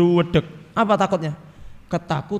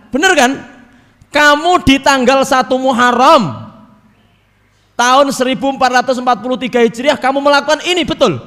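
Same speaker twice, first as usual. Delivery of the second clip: loud divine questioning; 7 semitones higher, 7 dB louder than usual. Acted. Preacher voicing Allah loudly interrogating the sinner. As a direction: boom out God's questions to the sinner.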